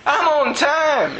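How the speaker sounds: high-pitched, giddy insistence; excited. Retold or imitated. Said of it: "I was just excited".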